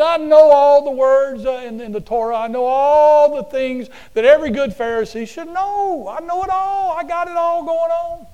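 preening religious scholar showing off; boastful Pharisee. Acted. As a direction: puff up like a smug know-it-all. Pharisee boasting, voiced in story.

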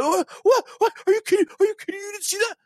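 high, loud whiny protest at the ref; mock outraged yell. Player yelling at the referee over a throw-in, mock outrage. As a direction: squeal in disbelief at a bad call. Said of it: "he starts yelling at the referee".

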